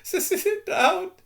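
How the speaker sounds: very high, stuttering wail of an elf. Acted. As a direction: sob the words out in disbelief. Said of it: "he wailed".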